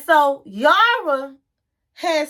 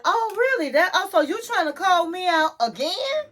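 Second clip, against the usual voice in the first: higher-pitched, challenging retold comeback; incredulous, pitched up; mock affronted; 6 semitones higher than usual. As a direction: imitate someone taking offense at being called out.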